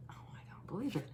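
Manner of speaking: fast breathless whisper of disbelief; whispered to herself in amazement; astonished whisper. Real, not acted. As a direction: hush it out, stunned. Surprised by the card drawn.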